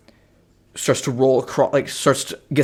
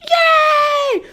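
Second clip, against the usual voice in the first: very high cartoon cheer; high-pitched cheer; squeaky cheer; 6 dB louder than usual. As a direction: squeal with joy. Kids cheering.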